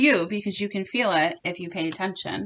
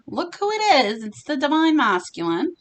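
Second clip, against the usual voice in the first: bright "look who it is" surprise; excited reveal; 6 semitones higher than usual. Real, not acted. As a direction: pitch jumps up, delighted to see the card.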